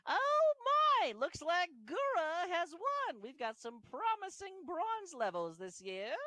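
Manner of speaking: high, booming, drawn-out showman; arena announcer. Acted. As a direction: announce the winner to the whole arena.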